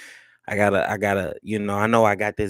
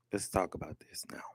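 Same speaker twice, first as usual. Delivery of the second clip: whisper; 4 semitones lower, 12 dB quieter, faster than usual. Real, not acted. Hushed aside before the topic.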